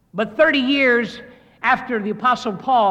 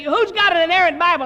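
rallying pastor; 8 semitones higher, 5 dB louder than usual. Retold or imitated. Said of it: "A pastor, you say".

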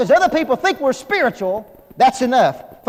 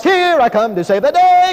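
higher, louder heroic cartoon-mouse song; Mighty Mouse; 7 semitones higher, 6 dB louder than usual. Husband playing hero to his wife, in a Mighty Mouse voice. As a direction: belt it out like a cartoon superhero swooping in. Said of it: "or at least a Mighty Mouse".

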